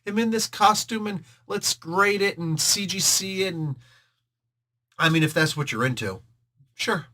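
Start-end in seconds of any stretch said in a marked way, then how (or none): 0.0-3.5 higher, mock-eager fan voice; mock eager collector. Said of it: "gonna be like".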